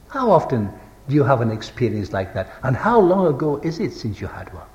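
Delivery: ordinary delivery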